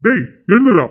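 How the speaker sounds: loud shout to rouse a sleeper; loud wake-up shout. Acted. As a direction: yell at him to get up now. Shaking unconscious son awake.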